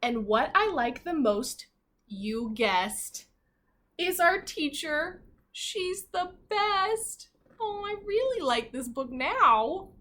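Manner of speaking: slow, sing-song reading of the rhyme; read-aloud to children. Acted. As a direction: read it slowly to a class of little kids. Reading picture book aloud.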